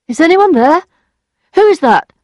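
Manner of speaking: high, loud questioning call. A narrator's reading. Justin hears a noise at night, calling out. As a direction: call out to whoever is there.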